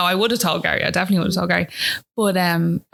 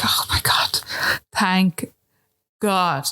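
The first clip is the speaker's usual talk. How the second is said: breathy relief; much slower than usual. Real, not acted. Whispered relief.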